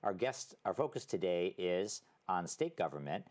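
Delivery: ordinary talk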